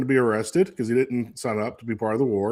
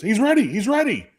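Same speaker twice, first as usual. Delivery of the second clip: higher, a pleased coach's quick call; coach's verdict; 11 semitones higher than usual. Retold or imitated. Coach on the sideline clearing a dazed player, higher and upbeat. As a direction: declare the player fit with a grin. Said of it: "He goes".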